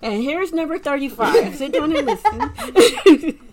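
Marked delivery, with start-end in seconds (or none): 1.6-3.4 higher, slow, louder playful order; mock command. Host joking to co-hosts, slow mock command. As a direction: bark it as a joking boss.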